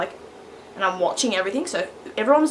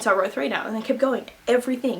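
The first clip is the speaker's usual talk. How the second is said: ordinary talk, nothing marked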